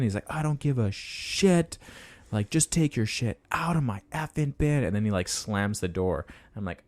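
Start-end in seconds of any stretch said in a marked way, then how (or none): 0.3-4.8 mock rage. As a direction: retell his outburst high, without shouting. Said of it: "this guy had rage".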